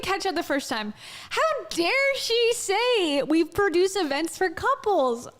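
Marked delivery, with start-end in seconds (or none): 1.3-5.3 higher, slow, hammed-up indignation; playfully overdone affront; mock outrage. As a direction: draw it out as if scandalized. Mock outrage at the played clip.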